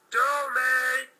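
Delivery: high, loud yell; loud high cry; loud shout. Acted. Character shouting.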